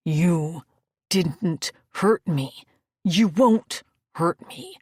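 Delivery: slow, pressed, insistent; through gritted teeth. Acted. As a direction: force each word out slowly. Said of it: "He spoke the words through gritted teeth".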